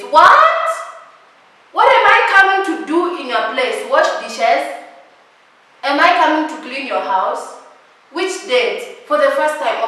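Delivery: shocked indignant outburst; outraged shout. Real, not acted. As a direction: yell it in shock. Outrage at house invitation.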